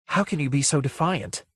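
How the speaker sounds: lightly voiced maiden. Shirl asks Guile how he dares, soft wonder. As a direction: ask in gentle amazement.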